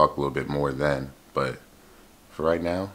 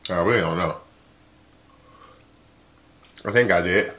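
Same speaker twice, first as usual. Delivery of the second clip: normal talking voice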